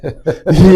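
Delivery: loud high laugh. Real, not acted. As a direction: burst of high-pitched laughter. Laughing while retelling.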